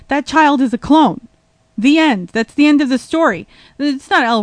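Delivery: hammed-up certainty; mock outrage.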